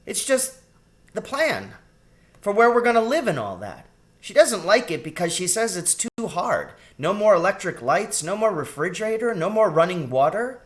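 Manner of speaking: put-on high, tumbling child's voice; piping little-girl explanation; exasperated girl rat. A narrator's reading. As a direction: list complaints like a youngster, pitched well up. Child rat explains plan breathlessly.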